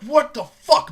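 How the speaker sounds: loud, sharp outburst of disbelief; indignant loud yelp; outraged shout. Retold or imitated. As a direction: call it out in outrage. Grandma slapped on the butt.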